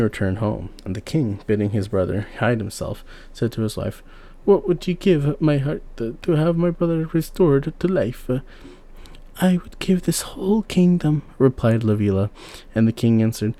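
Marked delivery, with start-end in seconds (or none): 4.4-8.3 higher, probing king; a husband sounding out his queen; raised, questioning voice; king testing his wife. A narrator's reading. 9.4-11.2 higher, eager queen; a wife offering her kingdom; raised eager voice. A narrator's reading. Queen answers.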